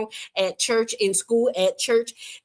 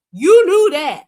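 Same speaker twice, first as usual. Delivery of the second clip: louder, higher you knew that, you hit hard; loud accusation; 8 semitones higher, 10 dB louder than usual. Real, not acted. Host telling a panelist he knew the topic, loud and accusing. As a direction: nail him with it.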